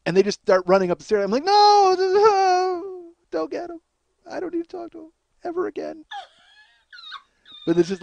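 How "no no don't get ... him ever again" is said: panicked pleading. Retold or imitated. To the kids running upstairs, begging them not to fetch Sky, panicked and high. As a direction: beg them to stop, in a panic.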